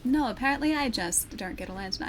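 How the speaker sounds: normal talking voice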